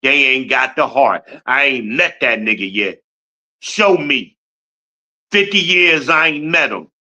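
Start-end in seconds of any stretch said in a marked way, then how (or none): none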